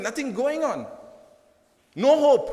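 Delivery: ordinary delivery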